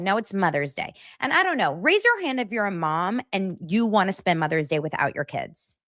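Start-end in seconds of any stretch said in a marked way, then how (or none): none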